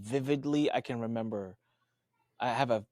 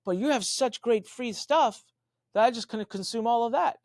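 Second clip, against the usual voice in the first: eager fan; 9 semitones higher than usual. Retold or imitated. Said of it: "he's like".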